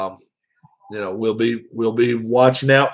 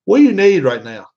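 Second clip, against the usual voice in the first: loud, higher, impatient bark; gruff impatience; 6 semitones higher, 5 dB louder than usual. Retold or imitated. Dad answering his son's call, loud impatient bark. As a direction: snap it like he's busy. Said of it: "the way he said it".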